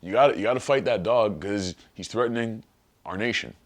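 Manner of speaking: ordinary talk, nothing marked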